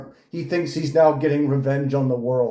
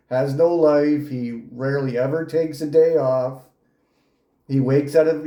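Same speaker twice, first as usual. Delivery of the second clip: usual speaking voice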